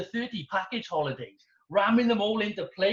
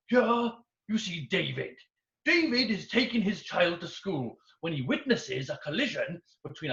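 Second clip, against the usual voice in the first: patronizing safety video narrator. Retold or imitated. Speed awareness video narrator, mock-solemn and patronizing. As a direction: lay out the doom like a stern training video. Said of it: "in such a patronizing way".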